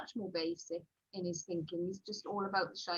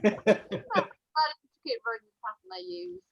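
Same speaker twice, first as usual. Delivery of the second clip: higher, louder echo in disbelief; retold incredulous echo; 8 semitones higher, 6 dB louder than usual. Author echoing her publisher's request, incredulous. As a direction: throw the phrase back, raised and louder.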